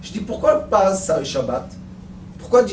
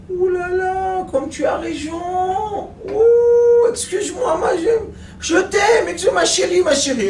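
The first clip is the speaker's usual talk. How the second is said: high, wailing hammed-up apology; mock sobbing apology; 11 semitones higher than usual. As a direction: whimper sorry to her, half crying. Jojo sobs apologies to wife. (excuses pleurnichées, voix aiguë et comique)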